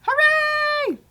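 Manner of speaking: high, loud little cheer. Real, not acted. Cohost cheering the working stop button, high loud hooray. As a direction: celebrate the win out loud. Playback stops as planned.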